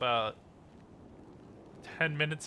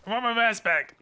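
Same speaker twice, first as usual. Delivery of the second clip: yelling after him; shouting; 8 semitones higher, 4 dB louder than usual. Acted. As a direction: call him back loudly. Chasing for his mask.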